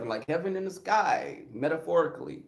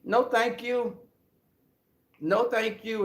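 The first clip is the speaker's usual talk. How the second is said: polite refusal; 6 semitones higher than usual. Retold or imitated. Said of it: "I was like".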